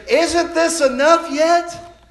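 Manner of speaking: complaining attitude. Acted. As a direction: hammer the complaint from the pulpit. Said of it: "that attitude where he says".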